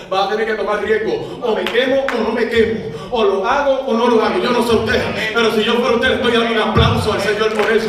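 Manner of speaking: loud, climbing preacher's call to applause; preaching at full force. Acted. Pastor rallies congregation, loud full-force preaching. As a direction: drive the congregation to clap.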